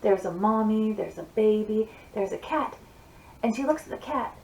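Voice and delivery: ordinary talk, nothing marked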